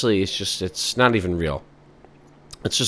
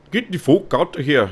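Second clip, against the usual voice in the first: higher-pitched put-on Canadian accent; exaggerated Canadian accent, pitched up; 7 semitones higher than usual. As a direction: do a mock Canadian telling someone off. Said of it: "They'd probably be like".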